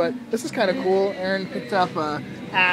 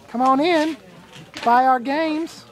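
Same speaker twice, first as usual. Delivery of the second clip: hammed-up Southern accent, higher and louder; a Southern shopkeeper calling shoppers into the booth, higher and louder; 6 semitones higher, 5 dB louder, slower than usual. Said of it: "I gave you a southern accent".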